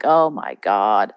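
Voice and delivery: imitated tired sigh of dismay; no energy. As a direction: act out being drained and dreading a chore. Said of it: "you just didn't have the energy".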